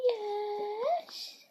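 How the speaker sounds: high, soft squeaky dog voice; dog's voice. Pretend. Girl voicing her dog's yes, high and soft. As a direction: answer as the dog, high and small.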